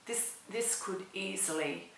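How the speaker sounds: usual speaking voice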